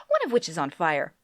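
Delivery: ordinary delivery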